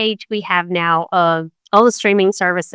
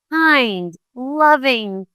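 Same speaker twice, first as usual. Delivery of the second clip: ordinary talk, nothing marked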